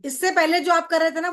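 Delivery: normal talking voice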